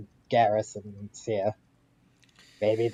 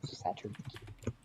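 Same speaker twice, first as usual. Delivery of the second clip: whispered; 13 dB quieter, slower than usual. Real, not acted. Repeating the name to himself.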